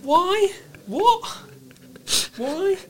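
very high-pitched, incredulous questioning; baffled squeak. Acted. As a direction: squeak each word out in mock bewilderment. Keeps up comic bafflement.

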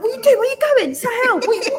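loud, high-pitched excited questions; high, urgent holler; calling out. Retold or imitated. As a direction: shout down from a balcony to a friend. Acts out calling down from balcony.

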